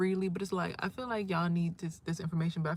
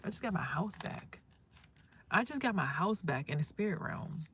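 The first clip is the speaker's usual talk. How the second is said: usual speaking voice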